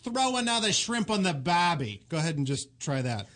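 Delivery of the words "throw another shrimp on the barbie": slow demo in an Australian accent; unhurried, put-on Australian accent. Shown as a demonstration. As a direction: model a broad Australian starter phrase. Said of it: "give you a little starter phrase".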